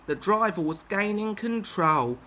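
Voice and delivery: ordinary delivery, nothing marked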